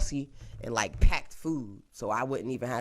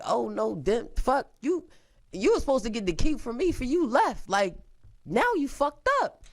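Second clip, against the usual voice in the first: high-pitched mock telling-off; acted scolding; 11 semitones higher than usual. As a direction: voice the manager's exasperated rebuke, pitched way up. Voices manager scolding her.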